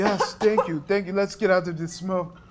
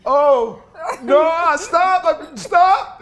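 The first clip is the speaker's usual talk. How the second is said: panicked shouting; 11 semitones higher, 11 dB louder than usual. Acted. Wakes up being kissed, panicking.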